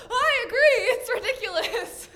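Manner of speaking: high, forced laughing agreement; nervous laughter. Acted. Girl agrees with skeleton's refusal, high nervous laughter. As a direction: laugh through the embarrassment.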